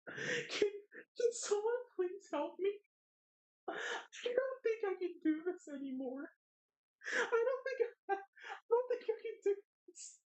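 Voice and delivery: very high, whimpering fake cry; mock sobbing. Streamer fake-sobbing that chat is abusing them. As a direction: wail like a hammed-up victim.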